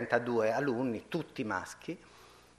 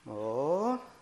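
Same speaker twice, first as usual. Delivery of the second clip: ordinary talk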